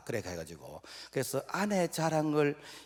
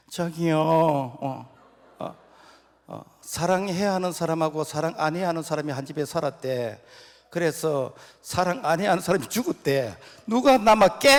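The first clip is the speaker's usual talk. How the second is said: loud, high playful riddle; teasing wife on the phone; 6 semitones higher, 9 dB louder than usual. Acted. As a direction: tease her husband into saying it. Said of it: "경상도 아내가". (전화로 수수께끼 내는 경상도 아내, 장난스럽게)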